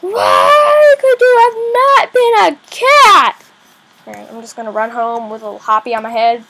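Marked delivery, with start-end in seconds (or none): 0.0-3.3 high, loud hammed-up crying lament; loud, slow, hammed-up sob; mock wail.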